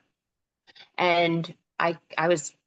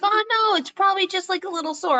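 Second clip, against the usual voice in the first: high, breezy brush-off; imitating the friend shrugging it off, pitched up; dismissive friend; 12 semitones higher, faster than usual. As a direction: wave it away lightly. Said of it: "he's like".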